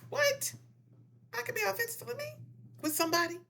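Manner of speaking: very high, squeaky-pitched incredulous question; high-pitched mock astonishment; mock disbelief. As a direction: act out someone who can't believe it, way up high. Voices an amazed listener's reaction.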